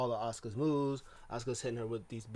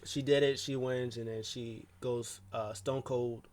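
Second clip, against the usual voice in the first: ordinary talk, nothing marked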